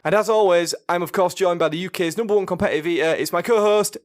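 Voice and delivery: smooth podcast host intro; radio voice. Acted. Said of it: "working on your radio voice".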